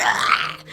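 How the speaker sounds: raspy mock baby shriek; gremlin screech. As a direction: screech like a little gremlin, held back. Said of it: "Like a whole gremlin".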